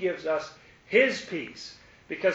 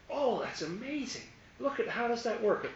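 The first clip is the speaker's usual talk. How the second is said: higher, lightly played child's amazement; a hint of a little kid marvelling; child's wonder; 7 semitones higher than usual. Preacher lightly voicing a curious child playing, mock amazed.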